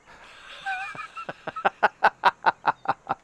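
long high-pitched laugh. Real, not acted. Laughs at bad lie.

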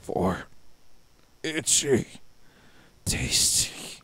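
breathy, broken, hungry whisper; zombie voice. Acted. Playing the zombie keeper for the viewers, a hungry whisper. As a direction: savor the word like a starving ghoul.

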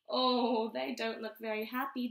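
ordinary delivery

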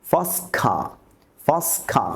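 ফসখা said as fas-kha, the t of first left out; first class with t dropped. Shown as a demonstration. Teacher showing students how the t drops, t silent. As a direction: glide first straight into class with no t. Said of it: "আমরা টি এবং ডির উচ্চারণ করব না".

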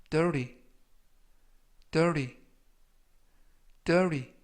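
dirty with the T softened to an r-like flap; soft T. Shown as a demonstration. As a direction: say "dirty" like "duh-ree", each time separate. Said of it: "알파벳 T는 부드럽게 르".

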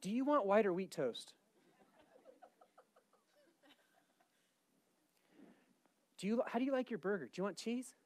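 soft, casual voice of a host taking orders; quiet, easygoing offer; unbothered God. Acted. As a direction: ask lightly, as if nothing is wrong. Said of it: "Here's God".

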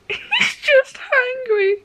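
high, slow, laugh-cracked voice; exasperated giggling plea; tearful laughter. Real, not acted. Reader begging Tanjiro why, laugh-crying.

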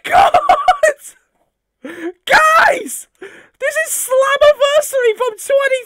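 high, loud screaming with joy; ecstatic shouting. Real, not acted. Fan unboxing a gift from Uncle Craig, ecstatic high screaming. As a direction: shriek like a fan who just got the gift.

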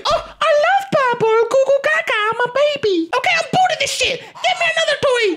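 put-on high demanding baby voice. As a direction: babble then demand like a toddler. Baby plays with toys.

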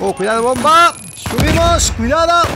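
very high, loud warning; alarmed yell. Real, not acted. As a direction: shriek the warning. Bomb warning.

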